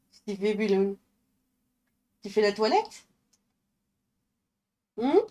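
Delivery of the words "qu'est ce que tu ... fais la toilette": quiet cooing to the cat; soft pet talk. Real, not acted.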